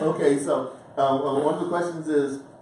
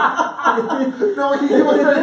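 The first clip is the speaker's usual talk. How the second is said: high loud laughter; laughing; 12 semitones higher, 10 dB louder, much slower than usual. Real, not acted. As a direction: burst out laughing. Group cracks up.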